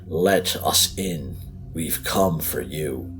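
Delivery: slow, uncanny otherworldly voice; slow eerie voice. Acted. Boy at the door luring Sandra, slow and eerie. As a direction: drag each word out like a ghost child.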